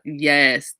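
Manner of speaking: normal talking voice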